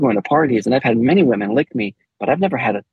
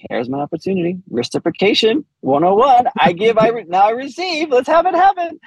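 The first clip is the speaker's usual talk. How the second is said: eager inner thought; 8 semitones higher than usual. Retold or imitated. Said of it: "here I'm thinking, I'm like".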